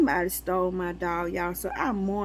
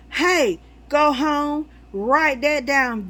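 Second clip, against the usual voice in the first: louder, higher, slow call to go home; excited; 6 semitones higher, 6 dB louder, slower than usual. Real, not acted. At a sign in the line, slow excited call. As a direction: spell it out like a revelation.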